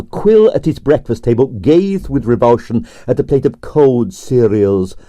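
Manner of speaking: ordinary delivery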